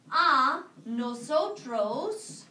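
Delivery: very slow A nosotros with rising tune; teacher modelling the answer form slowly; slow dictation. Shown as a demonstration. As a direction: draw it out for the class. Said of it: "answer in the nosotros".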